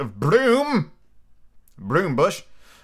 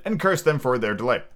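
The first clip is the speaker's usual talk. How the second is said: ordinary delivery, nothing marked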